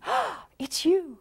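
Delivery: slow, high, delighted greeting; early delight. Shown as a demonstration. Early relationship, greeting a partner with delight. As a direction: savour every word with joy. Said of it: "So in the beginning".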